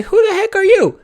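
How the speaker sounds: high-pitched mock indignant listener; squeaky, incredulous mock challenge; challenging listener. Host voicing a doubting listener, in high mock indignation. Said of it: "you might be thinking".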